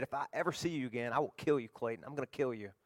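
low-key, softly voiced threat; soft mock menace; quiet retold threat. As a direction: retell the bully's threat quietly, understated. Bully's threat retold in story.

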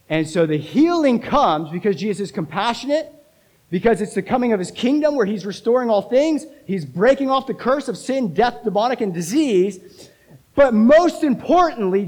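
high, emphatic proclamation; forceful preaching. Real, not acted. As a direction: proclaim it with mounting fervour.

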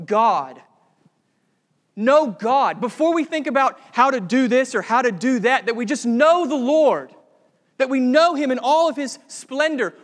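loud, driving pulpit voice; preacher urging the church to know God, loud and driving; full-force preaching. Acted. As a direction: hammer the point home to the congregation.

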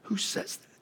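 very soft high whisper, almost no voice; awed whisper. Acted. Centurion whispering to himself in awe. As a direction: barely breathe the question in wonder. Centurion hears promise of paradise.

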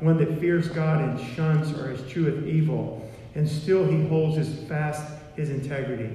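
loud, declaiming King James reading; scripture reading. Acted. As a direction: proclaim the verses to the congregation. Reads scripture aloud, loud.

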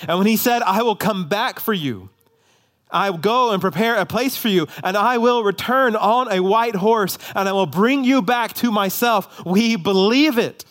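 high, driving sermon voice; full-force preaching. Acted. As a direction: preach it with rising fervour.

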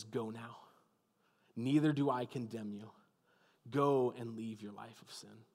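tender pardon spoken gently; gentle and patient. A narrator's reading. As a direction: speak it softly, with patience. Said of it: "with gentleness and patience".

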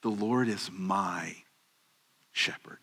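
slow and soft, as if confiding; tender hush for a personal promise; intimate. Shown as a demonstration. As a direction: savor "The Lord is my shepherd" quietly. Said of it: "it's very intimate".

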